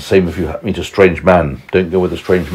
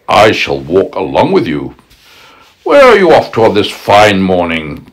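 sly wolf, hushed then louder; crafty wolf luring her along; 5 dB louder than usual. Acted. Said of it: "said the wolf".

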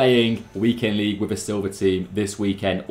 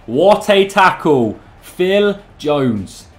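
loud, slow, drawn-out praise; savoring a brilliant tackle; excited exclaim; 6 semitones higher, 8 dB louder, much slower than usual. Real, not acted. Reacting to a great tackle.

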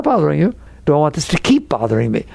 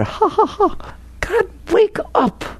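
mock laugh and wail; 3 dB quieter, slower than usual. Mocking laugh then pleading.

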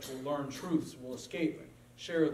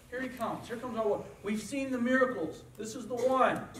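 higher, eager crowd voice; cheering crowd; 7 semitones higher than usual. Acted. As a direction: let the crowd's hope lift it.

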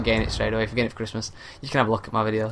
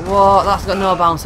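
sudden loud shocked yelp, pitched up; shocked what; 7 semitones higher, 7 dB louder, slower than usual. Real, not acted. Gamer killed out of nowhere, shocked loud what. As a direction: blurt it out in disbelief.

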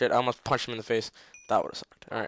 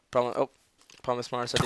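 usual speaking voice

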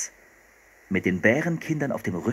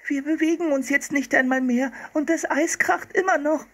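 whining. A narrator's reading. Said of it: "jammerte Anne".

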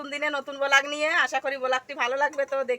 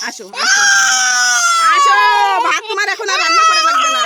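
much higher, loud exasperated call; high shout; 11 dB louder than usual. Real, not acted. Mother shouts at the crying toddler that cooking is over. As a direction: shout him away from the pot. Calling crying child away.

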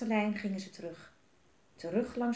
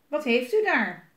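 loud, raised-pitch question; demanding director; 4 semitones higher, 9 dB louder than usual. A narrator's reading. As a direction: ask sharply as the boss.

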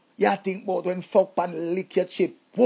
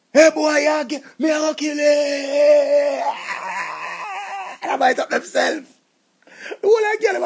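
crying rage; 5 semitones higher than usual. Acted. Heartbroken man wailing threats at the rival, sobbing. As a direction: wail the threat half in tears.

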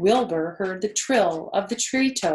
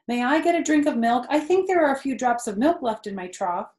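eager plea; 6 semitones higher, 6 dB louder, faster than usual. Acted. Wilbur asks eagerly.

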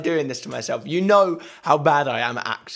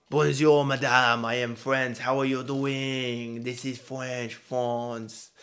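put-on clumsy French accent, sinking softer and lower; 4 semitones lower, 4 dB quieter, slower than usual. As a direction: try a bad, drawn-out French accent. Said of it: "What do French people sound like?".

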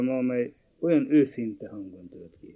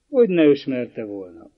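ordinary delivery

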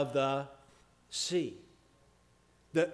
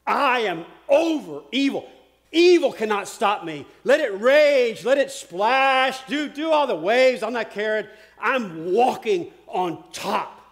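loud, raised, stretched-out declaration; defiant proclamation; 5 semitones higher, 8 dB louder than usual. Acted. Jesus defying evil as he walks the waves, loud proclamation. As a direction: thunder it out as a fearless challenge to evil.

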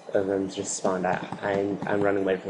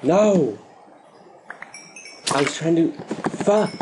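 high furious yelp; gamer's rage cry after a fail; frustrated scream; 7 semitones higher, 4 dB louder, slower than usual. Real, not acted. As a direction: shriek in anger at the game. Outburst after death.

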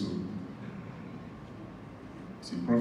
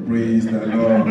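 slow, loud, laughing praise the lord; drawn-out praise; 7 dB louder, much slower than usual. Real, not acted. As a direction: stretch out the praise like a preacher. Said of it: "closing praise, laughing".

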